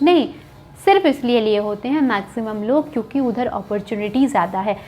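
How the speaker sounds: normal talking voice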